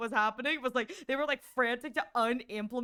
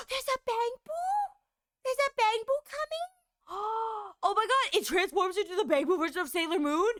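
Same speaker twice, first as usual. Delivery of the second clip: excited gasp; 8 semitones higher than usual. Real, not acted. Gasps at new character.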